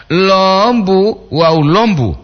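first lombu with a long high O, second lombu short; long versus short vowel. Shown as a demonstration. Host giving a second word comparison, long then short. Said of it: "itu perbandingan dia".

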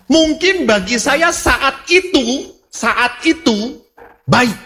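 high-pitched, emphatic sermon delivery; preaching. Real, not acted. Pastor teaching the congregation what prayer is, preaching.

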